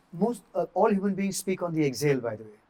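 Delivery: ordinary talk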